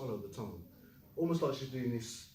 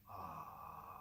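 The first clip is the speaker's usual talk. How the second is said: low, soft creaky "Ah"; vocal fry; 8 semitones lower, 13 dB quieter than usual. Shown as a demonstration. Teacher leading the class to say ah, in vocal fry. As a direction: croak it like you just woke up. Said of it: "vocal fry".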